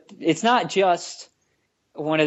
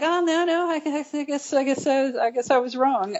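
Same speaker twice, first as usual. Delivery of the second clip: mock sheepish; 11 semitones higher than usual. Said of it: "And you're like".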